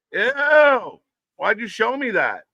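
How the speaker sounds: high, squealing disgust. Real, not acted. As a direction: recoil in disgust. Disgust at the cyst photo.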